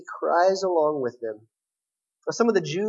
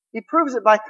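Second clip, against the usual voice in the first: normal talking voice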